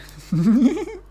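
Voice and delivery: squeaky laugh burst; high giggle. Real, not acted. Laughing at himself.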